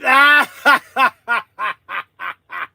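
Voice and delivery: helpless real laughing fit; losing it laughing. Real, not acted. Streamer about to lose it, helpless laughter. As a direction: break down into long, helpless laughter. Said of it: "I'm about ready to lose it".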